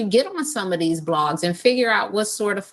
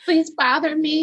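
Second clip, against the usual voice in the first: joining the plea; 10 semitones higher than usual. Pretend. Echoing co-host's plea.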